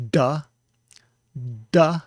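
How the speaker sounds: "da" explosivo, bem mais alto; plosive d. Shown as a demonstration. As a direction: bata a língua em "da". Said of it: "putting our tongue to the roof".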